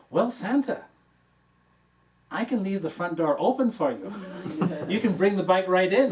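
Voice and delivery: put-on high, loud, cheeky child voice; bossy little girl. Four-year-old bargaining with Santa for a bike, high and loud. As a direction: argue like a four-year-old with hands on her hips.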